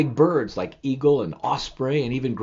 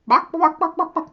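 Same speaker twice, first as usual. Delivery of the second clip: high squeaky chick clucks; chick clucking; 6 dB louder than usual. Acted. Chick clucking to his mother.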